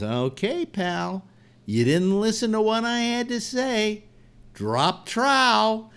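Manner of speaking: high, rising mock-menacing bear; a cartoonish bear laying down the law; gruff bear voice.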